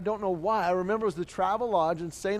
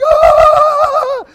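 high terrified scream flying downhill; 17 dB louder than usual. Retold or imitated. Boy on runaway tricycle, screaming high. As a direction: let out a wild high scream.

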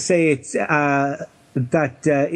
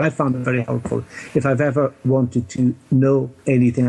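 ordinary delivery

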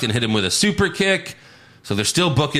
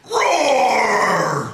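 long, high, drawn-out word "roar"; badly faked wrestler roar; mock roar; 9 semitones higher than usual. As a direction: say "roar" as a hammed-up roar. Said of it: "He roars".

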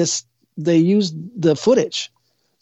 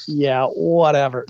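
slow, drawn-out brush-off; dismissive spirit guide; 2 semitones lower, slower than usual. Retold or imitated. Spirit guides brushing off diva psychics, slow and dismissive. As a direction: imitate an unimpressed guide, dragging the words. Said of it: "little spirit guides around them are going".

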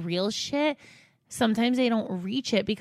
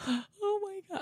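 retold crying gasp; 11 semitones higher, 7 dB quieter, faster than usual. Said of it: "I started hysterically crying".

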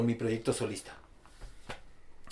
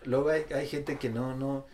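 ordinary talk, nothing marked

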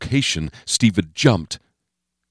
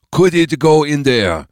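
put-on higher, accented, insistent question; Bo Pan's voice; 8 semitones higher, 4 dB louder than usual. A narrator's reading. Bo Pan pointing out the hatch, insistent accented voice. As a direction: press the question, pitched up, with an accent.

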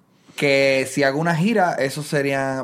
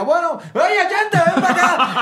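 high, bright-voiced hammed-up host farewell; mock TV host; 4 dB louder than usual. TV host waving goodbye on air, hammed up high. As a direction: play the cheesy show host sending him off.

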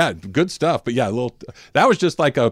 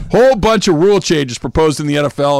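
normal talking voice

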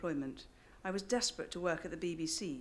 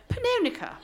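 much higher, loud, exasperated repeat of the name; desperate English tones; 8 dB louder than usual. Retold or imitated. Hannah repeating the name to the doorman, shrill and desperate. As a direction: sing out Pannonica, desperately English. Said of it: "I said in my slightly desperate English tones".

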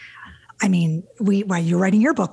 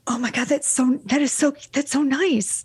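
excited; 6 semitones higher than usual. Real, not acted. Hearing she married the man.

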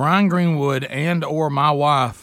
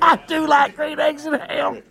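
shouted rhyme; 9 semitones higher than usual. Pretend. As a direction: belt the Dr. Seuss line out.

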